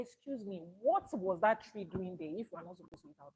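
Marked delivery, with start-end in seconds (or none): none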